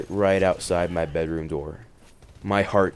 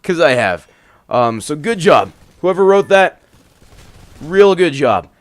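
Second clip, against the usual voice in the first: much louder and higher, fast-building rant; excited comic rant; 8 semitones higher, 10 dB louder than usual. Real, not acted. Narrator ranting to his listeners after the scary story, loud and excited. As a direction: rant to listeners about being scared.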